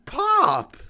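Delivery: loud embarrassed cry of Pop; shocked shout. Real, not acted. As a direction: yell it out in shock. Son protests loudly.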